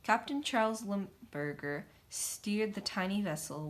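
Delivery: ordinary delivery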